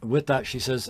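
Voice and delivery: ordinary talk, nothing marked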